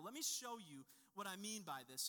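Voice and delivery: ordinary talk, nothing marked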